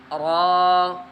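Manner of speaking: ordinary talk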